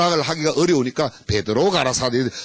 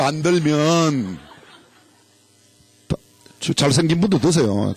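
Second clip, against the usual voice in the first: ordinary talk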